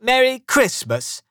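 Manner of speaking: high, loud mocking greeting; scornful echo. A narrator's reading. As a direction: throw the greeting back with scorn. Scrooge mocks the greeting.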